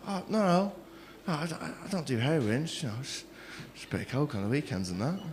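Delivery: very soft, low, hesitant reply; acted-out embarrassed admission, trailing away; sheepish mumble. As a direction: shrink back and murmur the excuse. Sheepish reply to drug counsellor.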